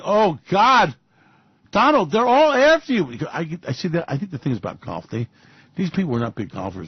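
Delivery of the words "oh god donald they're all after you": mock alarm. Mock alarmed address to Donald.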